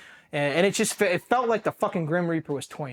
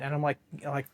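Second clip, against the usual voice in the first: ordinary delivery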